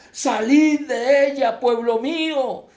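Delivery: high, loud, drawn-out summons; voice from heaven. Acted. Voice from heaven summoning God's people, loud slow call. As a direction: call out slowly and loudly to your people. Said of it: "otra voz del cielo que decía".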